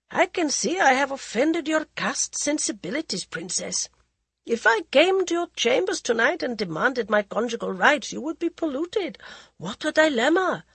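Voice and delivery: cool, mocking aristocrat voice; mocking prince. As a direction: sneer it like a bored royal. Prince Pratap mocks bride.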